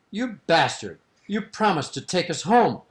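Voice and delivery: mock outrage at a broken promise; saucy. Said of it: "they're very saucy".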